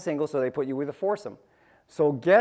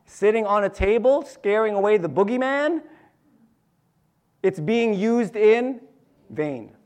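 preaching; 3 semitones higher, 5 dB louder, slower than usual. Acted. Preaching with rising force.

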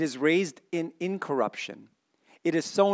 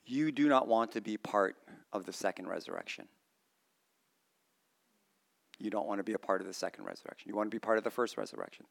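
soft grave warning; 5 semitones lower, 9 dB quieter, faster than usual. Real, not acted.

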